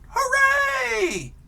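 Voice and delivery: a high, loud cheer. Acted. Teacher voicing the winners in the book, high loud cheer. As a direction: shout hooray for the winning side.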